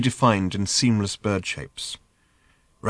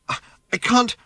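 high, bewildered stammer; 13 semitones higher than usual. A narrator's reading. Girl asked about a shape-shifting bird, bewildered stammer. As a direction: stumble on the first word, at a loss.

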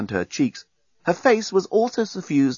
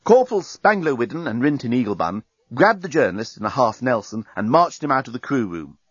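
usual speaking voice

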